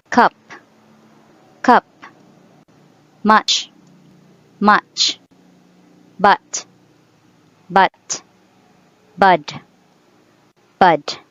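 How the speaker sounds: cup, much, bud with a clipped uh; fast short vowel; quick short u. Shown as a demonstration. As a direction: say the uh real quick. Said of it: "that is to be pronounced real quick".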